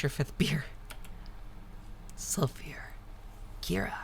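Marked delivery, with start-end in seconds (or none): none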